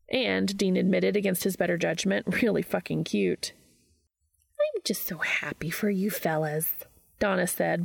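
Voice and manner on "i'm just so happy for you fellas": hushed, whispery sweetness; pleasant and sweet. A narrator's reading. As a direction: coo it sweetly, nearly whispered. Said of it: "voice pleasant and sweet".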